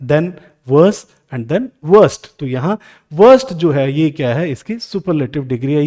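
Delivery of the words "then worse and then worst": each word drawn out slowly for the class; deliberate teacher dictation; very slow, spelled out. Shown as a demonstration. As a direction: lay the forms out one by one, very slow.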